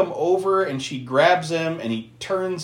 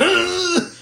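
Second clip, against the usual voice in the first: high, mock constipated grunt; exaggerated grunt of effort; straining grunt. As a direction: imitate someone straining hard. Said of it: "she starts grunting".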